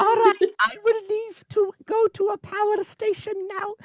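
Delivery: hammed-up high singer impression. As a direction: intone it high like a possessing spirit.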